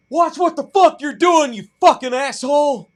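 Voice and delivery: high, very loud furious yelling; screamed road rage. Retold or imitated. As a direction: imitate an angry stranger screaming abuse. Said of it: "this weird guy who screamed at me".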